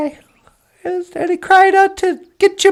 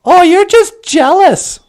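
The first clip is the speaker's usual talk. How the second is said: louder sneering dismissal; dismissive sneer; 4 semitones higher, 5 dB louder than usual. Acted. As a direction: brush off the crow in a huff. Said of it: "Amik was all".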